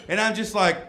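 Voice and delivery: usual speaking voice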